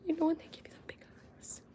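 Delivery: soft unvoiced whisper. Real, not acted. Telling viewers about puppy eyes in a whisper. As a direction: hush it as if the dog could hear.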